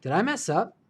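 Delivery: suddenly realizing the mistake; did I mess up, now alarmed; alarmed realization. Real, not acted. Louder alarmed repeat.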